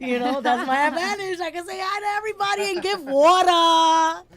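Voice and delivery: high, loud excited rush. Real, not acted. Excited about her advantage.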